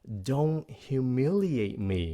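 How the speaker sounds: lightly acted maternal reproach; scolding mother. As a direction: draw it out like a mother warning a child. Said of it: "mẹ mình nói rằng là".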